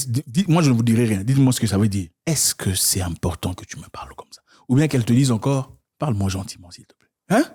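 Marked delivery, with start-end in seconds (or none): 2.3-4.4 soft whisper of a wife; gentle whisper. Shown as a demonstration. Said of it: "il faut savoir parler avec douceur". 6.0-7.1 softer, fading plea; fading soft request. Shown as a demonstration. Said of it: "parler avec douceur".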